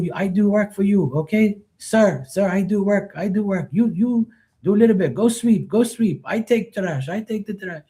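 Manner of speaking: high-pitched, eager, mock-accented worker voice; imitating the worker. Worker insisting to dad he'll do the work, in a high, eager put-on voice. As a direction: pitch it up like the polite helper insisting.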